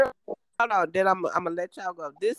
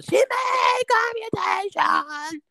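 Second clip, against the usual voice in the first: high, loud, slow shouted announcement; retold guard shout; 11 semitones higher, 5 dB louder, slower than usual. Guard calls out to the whole pod, high and loud. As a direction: call it out across the unit.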